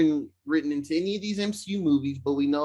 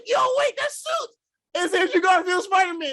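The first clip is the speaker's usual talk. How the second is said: much higher, shouted disbelief; astonished fan shout. Retold or imitated. Fan recalling the crowd realizing it's Garfield, high shout. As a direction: yell it like the whole theater just lost it. Mimicking audience's astonished reaction.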